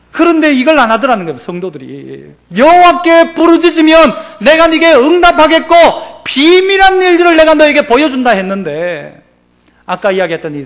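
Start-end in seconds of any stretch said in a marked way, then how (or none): 2.6-8.3 thundering scripture reading; full-force preaching. Acted. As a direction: proclaim God's promise.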